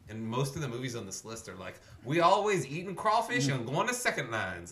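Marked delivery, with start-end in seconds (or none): 2.0-4.7 slow, louder and higher, hamming it up; mock tourist-movie local. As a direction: parody the clichéd movie New Orleanian. Mocking movie stereotype of locals.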